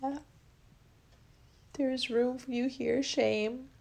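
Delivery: slow, soft healing affirmations; guided affirmation. Acted. Host guiding listeners to welcome each feeling, soft affirmation. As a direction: let each word land gently, slowly. Leads a guided emotional release.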